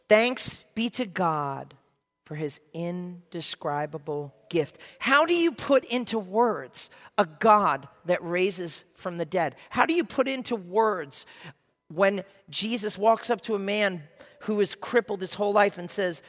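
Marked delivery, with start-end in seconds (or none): 0.1-4.8 scripture reading. Acted. Reads scripture verse slowly. 5.0-14.6 slow preaching emphasis. Acted. Rhetorical preaching, slow and loud.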